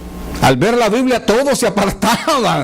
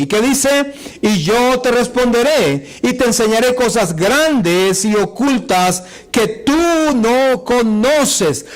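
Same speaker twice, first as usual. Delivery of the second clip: emphatic preaching; 6 semitones higher than usual. Real, not acted. Preacher urging the congregation to call on God, emphatic and high. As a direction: preach it with rising urgency, stretching the verse.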